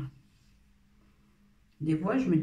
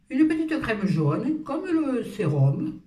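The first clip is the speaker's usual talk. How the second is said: high, slow cooing voice; put-on sweet voice; 7 semitones higher, slower than usual. Beauty vlogger presents her cream in a slow cooing voice. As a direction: coo it like a sweet little sales pitch. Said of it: "Oh, pourquoi je parle comme ça?".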